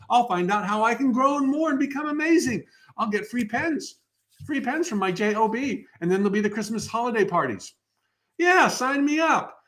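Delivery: a soul gushing about going to work; eager spirit. Pretend. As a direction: sign up like a thrilled newcomer. Eager spirit choosing life.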